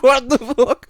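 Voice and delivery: high, loud, laughing what the; baffled outburst. Retold or imitated. Retelling his baffled reply to the Swede, laughing.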